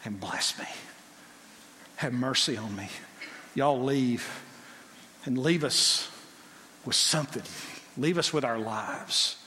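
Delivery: soft, low begging; pleading tone. Acted. Pharaoh begging Moses, soft low pleading. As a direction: beg quietly for mercy. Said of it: "a change of tone".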